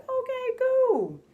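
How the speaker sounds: very high sing-out, dropping at the end; mock-chipper, way up high; high-pitched acceptance. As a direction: squeak out an agreeable reply, held long. Acting own reply to glowing report.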